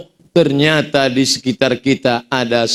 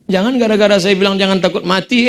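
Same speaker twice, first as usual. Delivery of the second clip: ordinary delivery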